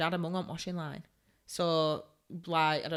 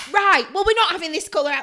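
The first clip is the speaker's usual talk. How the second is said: loud, high, fed-up outburst; exasperated retort; 10 semitones higher, 11 dB louder than usual. Retold or imitated. Daughter answering mum's colour complaint, exasperated. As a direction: burst out, completely fed up with her. Retold snap at fussy mum.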